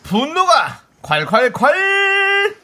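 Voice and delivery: 아주 높고 느리게 길게 외치는 제목; 과장되게 늘여 외치기; dramatic title shout. Acted. 코너 타이틀 외침. (과장된 타이틀 외침)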